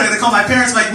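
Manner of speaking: normal talking voice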